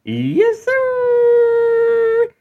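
high stretched excited yes sir; hype yell. Acted. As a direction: whoop it up like a hype man. Host shouts hype catchphrase.

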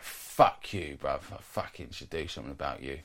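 low, quiet imitation of drunk menace; really aggressive. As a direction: imitate a threatening drunk under your breath. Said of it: "like, really aggressive".

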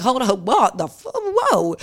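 high-pitched mock shock; acted alarm rising sharply in pitch; taken aback. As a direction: act out startled protest, breaking into whoa. Retold shock at the abuse.

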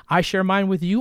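usual speaking voice

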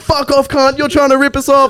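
high-pitched indignant tirade; mock angry uncles. Uncles refusing to pay the driver, mock angry. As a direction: imitate relatives seeing someone off.

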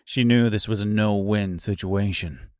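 plain reading voice

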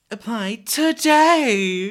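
high, loud, very slow mock announcer; over-dramatic announcement. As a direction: ham up the store announcement, stretching today. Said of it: "it's so dr-, over dr".

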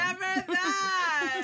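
high, slow, stretched-out wail of denial; loud drawn-out protest. Real, not acted. Rejecting a friend's teasing, loud drawn-out protest.

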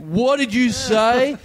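drawn-out high threatening challenge; aggressive challenge. Retold or imitated. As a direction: square up to him, menacing. Thugs confronting after insult.

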